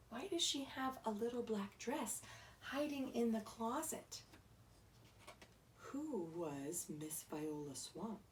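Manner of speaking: ordinary delivery, nothing marked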